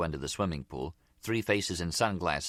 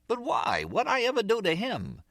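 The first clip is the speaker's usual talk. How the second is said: high-pitched, pleading protest. A narrator's reading. Harry asks why the Zip wants him, protesting. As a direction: plead your case in a high voice.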